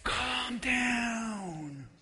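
soft, slow calm down to yourself; soft self-calming. Shown as a demonstration. Congregant calming themselves, soft and drawn out. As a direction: breathe it out to yourself. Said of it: "say it one more time to yourself".

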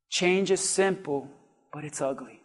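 ordinary talk

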